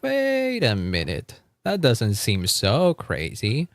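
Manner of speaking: slow, drawn-out wait a minute; dawning mock realization. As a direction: realize it slowly, weighing it up. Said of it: "and I was like".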